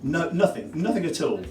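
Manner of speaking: usual speaking voice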